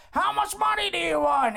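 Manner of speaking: high, hollered offer; blustering boss. Acted. Boss asking Girardi his price, hollering. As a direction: ham up a big-spending boss.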